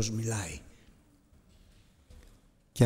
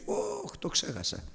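caught-out oops; 8 dB quieter, slower than usual. Retold or imitated. Retold oops when caught forgetting.

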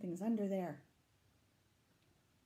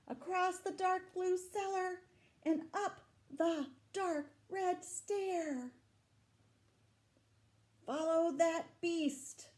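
higher, chase-like narration; reading the chase to children, higher and eager; excited story reading; 11 semitones higher, 6 dB louder, slower than usual. A narrator's reading. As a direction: urge them on after the beast. Reading story text dramatically.

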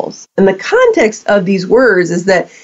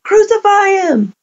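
high-pitched mock yell at normal volume; crowd yelling; 10 semitones higher than usual. Crowd calls for Jesus to die, mock yelling. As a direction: imitate a yelling mob, pitch lifted sharply. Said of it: "crowd that was yelling".

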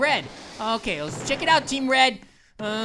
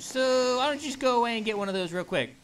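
normal talking voice

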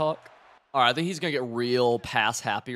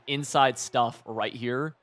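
normal talking voice

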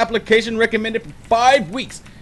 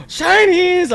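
excited squeal; 12 semitones higher, 4 dB louder than usual. Real, not acted. Gasps at sparkly item.

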